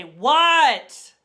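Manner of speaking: loud, high shout down a hallway; shouted reply. Acted. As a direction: holler back from another room. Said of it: "down the hallway".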